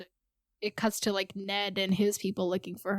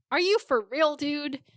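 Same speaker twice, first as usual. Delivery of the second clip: mock disbelief; 6 semitones higher, 5 dB louder than usual. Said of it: "And that's like".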